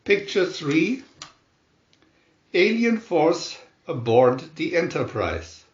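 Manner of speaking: ordinary delivery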